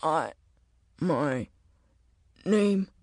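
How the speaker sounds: soft, halting words from an injured man; faint rasp. Acted. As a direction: force out each word weakly. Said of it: "his voice a rasp on the edge of silence".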